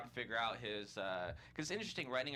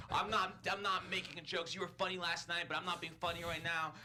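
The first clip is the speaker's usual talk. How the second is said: higher-pitched mock scolding; raised, indignant imitation; imitated furious rant; 6 semitones higher, 3 dB louder than usual. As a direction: insist angrily, as a retold telling-off. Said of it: "she's, like, at a ten".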